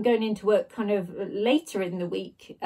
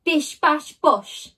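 loud chanted kick sounds; kicking sound effect; 4 semitones higher, 5 dB louder, much slower than usual. A narrator's reading. Narrator voicing boots kicking the door, loud. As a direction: punch out each kick.

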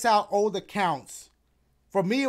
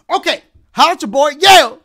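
hype loud sign-off; 7 semitones higher, 17 dB louder than usual. Real, not acted. Streamer shouting a quick, hyped farewell to his viewers. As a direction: shout the goodbye, quick and bright.

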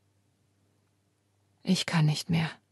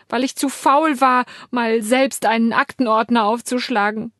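sobbing; 7 semitones higher, 3 dB louder than usual. Acted. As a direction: sob it into the blanket. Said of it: "schluchze ich in diese Decke".

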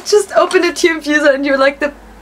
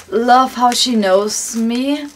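ordinary talk

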